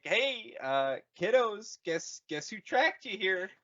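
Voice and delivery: high, hammy bad-guy greeting; a touch of a cheesy villain; mock stormtrooper voice. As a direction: taunt them like a smug villain. First Order voiced.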